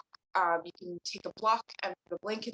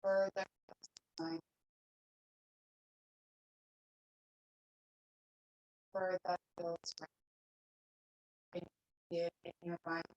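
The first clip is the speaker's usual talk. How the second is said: soft, unhurried meditation voice with long pauses; guided meditation; 8 dB quieter than usual. Real, not acted. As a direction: guide them gently into stillness, leaving long silences. Leads a seated meditation.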